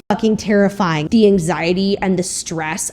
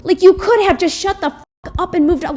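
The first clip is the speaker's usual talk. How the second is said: exasperated rant; 10 semitones higher than usual. Real, not acted. Ranting at James Charles.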